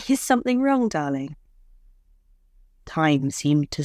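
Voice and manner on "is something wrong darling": anxious question. Acted. Wife anxious at silence.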